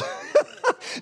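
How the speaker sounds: high, airy burst of laughter; helpless high-pitched giggle. Real, not acted. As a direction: lose it laughing mid-sentence. Breaks up again mid-sentence.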